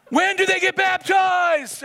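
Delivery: high, slow, loud mock question; mock shouted question. Pastor retelling a skeptic's question, mock shout. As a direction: bellow the question up high and slow, half joking.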